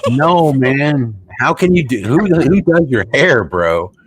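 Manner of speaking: high, loud and slow, ridiculing his hair; scoffing at the prime minister's hair, high and slow; mocking disbelief. Real, not acted. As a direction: jeer in disbelief, dragging the words.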